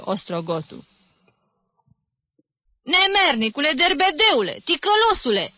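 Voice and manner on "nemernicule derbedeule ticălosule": high, loud outraged shouts; furious yelling. A narrator's reading. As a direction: hurl each insult in a high yell. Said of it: "țipă acesta ultraviolet la față".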